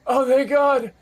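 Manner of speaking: high, slow cry of relief; relieved halfling. Acted. Rescued halfling thanking the party, high and relieved. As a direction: gush with relief at being found. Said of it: "He goes".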